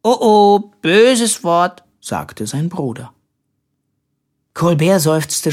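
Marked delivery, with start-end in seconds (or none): 0.0-1.8 high, louder sing-song tattle; childlike chiding. A narrator's reading. Simpel catching his brother swearing, childlike sing-song. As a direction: scold playfully like a small child.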